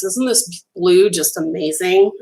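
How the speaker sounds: ordinary talk, nothing marked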